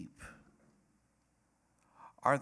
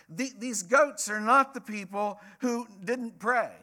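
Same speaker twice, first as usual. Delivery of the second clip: raised, insistent preacher's voice; emphatic preaching; 9 semitones higher, 5 dB louder than usual. Real, not acted. Preacher correcting the church on who the goats are, emphatic.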